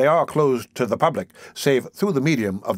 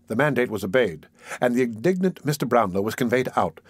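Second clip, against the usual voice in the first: usual speaking voice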